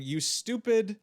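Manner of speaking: loud, high-pitched retold outburst; mock exclamation. Quoting Chivo scolding the director, loud mock exclamation. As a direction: throw it out as an exasperated quote.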